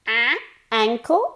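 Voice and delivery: ankle with a loud, very nasal a; exaggerated nasal ankle. Shown as a demonstration. Teacher overdoes the vowel for the recording. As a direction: ham up the nose sound in ankle. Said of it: "lo estoy exagerando".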